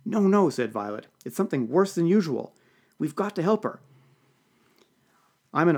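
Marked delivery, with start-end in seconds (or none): 0.1-0.5 high, loud protest; urgent objection. A narrator's reading. 1.3-3.8 high-pitched worried insistence; urgent plea. A narrator's reading. Violet urges him to help.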